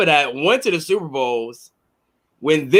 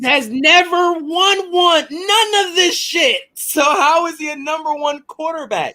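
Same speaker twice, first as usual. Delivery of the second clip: high-pitched, slow, heated; heated high protest; 10 semitones higher, slower than usual. Real, not acted. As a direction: raise the pitch in disbelief.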